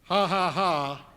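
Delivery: slow deadpan ha ha ha; dry fake laugh. Real, not acted. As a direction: laugh it out flatly, unamused.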